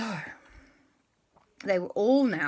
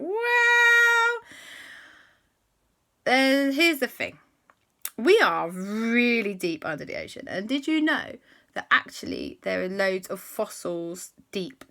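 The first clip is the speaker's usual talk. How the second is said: a know-it-all frog explains; giant frog voice; 2 semitones higher, 7 dB louder than usual. Acted.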